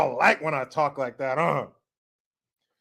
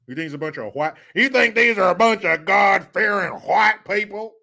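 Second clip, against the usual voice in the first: normal talking voice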